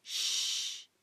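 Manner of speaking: long breathy shushing; old man's frightened shh; hushing shh. A narrator's reading. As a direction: shush him urgently. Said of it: "לחש הזקן".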